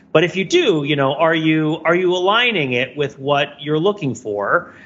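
ordinary talk

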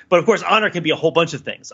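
ordinary delivery

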